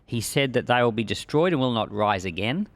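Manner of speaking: ordinary talk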